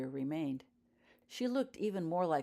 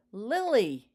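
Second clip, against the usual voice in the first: loud, high cry of disbelief; amazed gasp; 6 semitones higher, 10 dB louder than usual. A narrator's reading. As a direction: blurt the name in shock. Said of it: "he thought in amazement".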